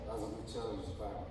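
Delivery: Matt's real reply, muttered softly and fast; mumbled flat quote. Retold or imitated. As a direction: say it quick and quiet like Matt really did. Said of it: "That's really what he said".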